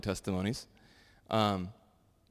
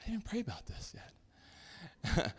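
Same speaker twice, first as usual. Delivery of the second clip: sheepish realization; 10 semitones higher, 8 dB quieter, much slower than usual. Retold or imitated. Realizing mid-drive he forgot prayer.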